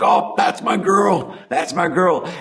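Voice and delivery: imitating a father's teary pride; choked up. Dad on the sidelines praising his winning daughter, mock choked up. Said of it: "he's all choked up".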